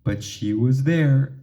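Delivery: ordinary delivery, nothing marked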